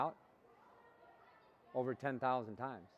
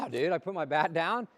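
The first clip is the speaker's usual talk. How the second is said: normal talking voice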